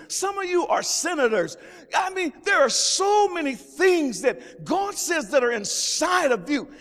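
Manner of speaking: rising pulpit fervor; preaching at full force. Acted. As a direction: build the sermon up. Preaching builds up.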